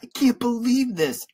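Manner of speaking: put-on high-pitched boy's protest; crying out. A narrator's reading. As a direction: cry out in upset disbelief. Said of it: "I cried".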